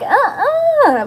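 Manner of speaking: very high-pitched squeal of protest; alarmed refusal. Retold or imitated. Patient balking at a room full of doctors, alarmed. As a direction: squeak out a hammed-up no.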